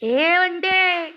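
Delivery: long drawn-out call up to the balcony. Retold or imitated. Friend calling up to the balcony, drawn-out call. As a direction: stretch out the name to call a friend down. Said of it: "balcony में से आवाज़ लगाया".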